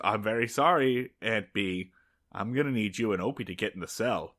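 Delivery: low, soft villain apology; lightly voiced polite gunman, hushed and low; Gentleman Dan. Gunman telling Aunt Bee to get in the cell, softly menacing. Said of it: "Gentleman Dan says".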